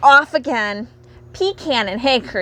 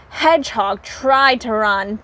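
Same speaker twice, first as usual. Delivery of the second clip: plain reading voice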